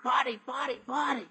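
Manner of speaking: strained hoarse shout. Acted. Archie shouting party into the phone to Betty, hoarse and strained.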